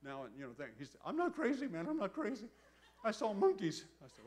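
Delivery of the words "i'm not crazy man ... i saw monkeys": imitated frantic insistence. Friend insists he saw monkeys.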